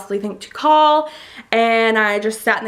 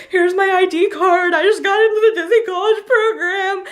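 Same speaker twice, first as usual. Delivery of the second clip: high, tearful, overexcited gushing; shaking with emotion; 10 semitones higher, 3 dB louder than usual. Retold or imitated. Student handing ID card to the lady, shaking, tearful. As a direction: re-enact blubbering joy. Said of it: "I'm, like, shaking".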